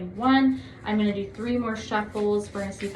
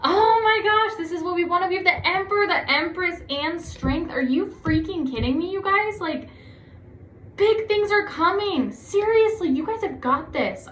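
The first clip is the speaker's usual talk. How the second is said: thrilled; 8 semitones higher than usual. Real, not acted. Tarot reader thrilled by Sagittarius cards, squealing with real excitement. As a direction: gush over the cards as if you can't believe it. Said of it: "I'm so excited for you".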